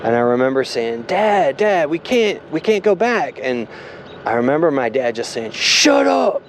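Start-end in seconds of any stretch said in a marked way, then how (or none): 1.1-3.4 put-on high-pitched, urgent kid pleading with dad; scared kid. Child warning dad about going backwards, scared and pleading. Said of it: "so scared, and I was afraid". 5.5-6.4 sharp, high-pitched mock yell; dad snapping. Dad silencing his worried kid, retold as a sharp snap.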